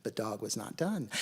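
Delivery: usual speaking voice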